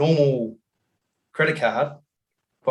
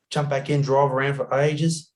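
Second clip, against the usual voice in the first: ordinary talk, nothing marked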